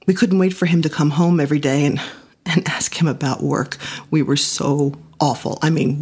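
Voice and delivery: usual speaking voice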